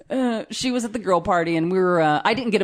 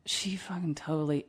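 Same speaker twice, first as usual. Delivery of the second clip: softer, unvoiced confiding whisper; retold whisper; 3 semitones lower, 11 dB quieter than usual. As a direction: whisper it like a secret in the car. Wife confides in the car.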